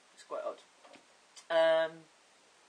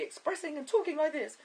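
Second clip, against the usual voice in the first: much higher, over-expressive speech; exaggerated old voice; 11 semitones higher than usual. Shown as a demonstration. Vlogger showing viewers how they used to talk, high and exaggerated. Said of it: "that was really exaggerated".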